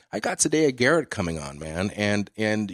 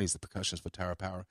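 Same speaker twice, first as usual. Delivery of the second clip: hushed aside; 5 semitones lower, 13 dB quieter, faster than usual. Retold or imitated. Friend's hurried aside to Crosby.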